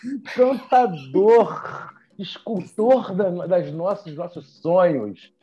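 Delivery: slow, booming talk show host announcement; TV show intro. Acted. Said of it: "aquela introduçãozinha de televisão".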